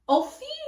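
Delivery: "Ophi" said OH-fee, rising; trial pronunciation. Shown as a demonstration. Reader doubtfully tests another version of the name, trial pronunciation. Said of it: "I've also heard it's like".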